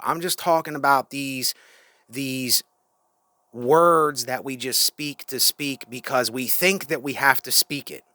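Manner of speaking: ordinary delivery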